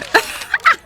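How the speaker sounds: sudden high burst of laughter. Acted. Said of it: "bust out in pure laughter".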